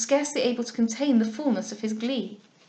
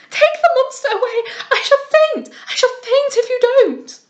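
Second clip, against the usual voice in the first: shrill frightened scream; 13 semitones higher, 9 dB louder than usual. A narrator's reading. Lady Louisa shrieking at the monkey, very high and loud. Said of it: "her fright and screaming rendered extremely shrill".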